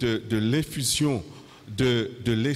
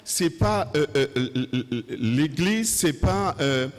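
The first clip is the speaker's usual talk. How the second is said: fiery preaching; 7 semitones higher than usual. Acted. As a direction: preach it out with full force. Preacher at full force.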